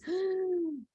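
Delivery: soft, high gasp; small pretend gasp of fright; mock-frightened gasp. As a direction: gasp as if startled, for a child. Mom's scared comment replayed.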